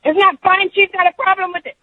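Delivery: high-pitched mock holler; shrieky imitation of yelling; imitated screaming. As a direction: screech it like an angry coworker. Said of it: "she's just hollering and screaming at me".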